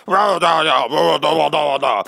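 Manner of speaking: hammed-up caveman critic; caveman critic grunts. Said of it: "which is caveman for".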